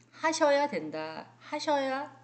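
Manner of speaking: ordinary talk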